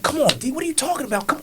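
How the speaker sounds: high, mocking brush-off; mock scoffing. Wife mocking her husband's quitting, high scoff. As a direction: scoff at him like he's being silly. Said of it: "She could have easily been like".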